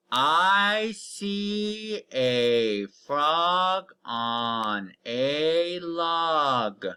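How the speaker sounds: very slow, word by word, each word drawn out; very slow read-aloud. Shown as a demonstration. Teacher reading a sentence to the class, very slowly. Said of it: "Read it with me".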